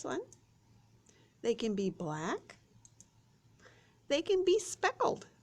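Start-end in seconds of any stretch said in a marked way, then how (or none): 4.1-5.3 delighted. Acted. Showing speckled chicken to kids.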